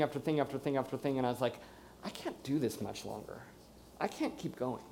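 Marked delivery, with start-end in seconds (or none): 2.0-4.8 soft, low, given-up voice; defeated. Retold or imitated. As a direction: quietly recall being at the end of your rope. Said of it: "being so defeated by this".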